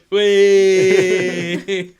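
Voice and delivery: the whole crowd jeers the fall; crowd cheer. Acted. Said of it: "the whole crowd just goes".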